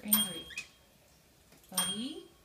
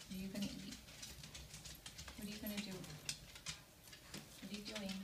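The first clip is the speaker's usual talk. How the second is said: gentle coaxing; 13 dB quieter than usual. Real, not acted.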